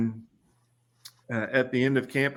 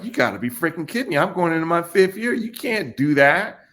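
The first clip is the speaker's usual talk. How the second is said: higher, indignant retold outburst; mock protest; 7 semitones higher, 4 dB louder than usual. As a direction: replay your disbelief, half-hearted.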